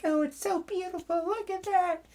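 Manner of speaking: very high falsetto gush; mock gushing. Survivor cooing over the newborn baby, high falsetto mock gush. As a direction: coo it like a doting new parent. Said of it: "It's like".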